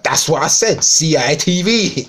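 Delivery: high, loud mock protest; indignant comeback, hammed up; exasperated retort. Acted quarrel over his pronunciation.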